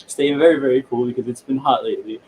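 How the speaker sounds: ordinary talk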